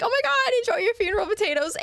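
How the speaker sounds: high, fast, sing-song sarcastic cheer; mock chipper. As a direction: toss off a bright sarcastic send-off. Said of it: "I'm like".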